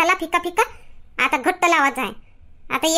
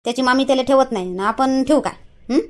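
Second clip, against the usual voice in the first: put-on a touch of an older mother's voice, lower; lightly voiced mother; 5 semitones lower than usual. Mother asking father to keep the boy, lightly voiced.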